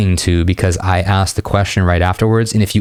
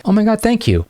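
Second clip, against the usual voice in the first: much higher, lightly voiced girlish thanks; a hint of a grateful girl; 13 semitones higher than usual. Man voices the woman he complimented, girlish grateful thanks. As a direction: play the surprised woman he just complimented.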